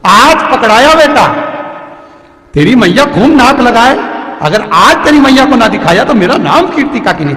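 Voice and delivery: high, loud gloating at a caught thief; old woman storming in, crowing in triumph; loud mock-angry auntie; triumphant scolding. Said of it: "धड़धड़ाती हुई".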